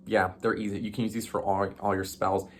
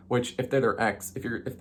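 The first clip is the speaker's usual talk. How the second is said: ordinary talk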